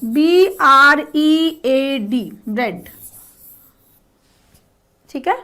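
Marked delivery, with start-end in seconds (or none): 0.0-2.3 बी आर ई ए डी spelled slowly, letter by letter. Shown as a demonstration. Teacher spells bread slowly for the students. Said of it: "right answer होगा ब्रेड".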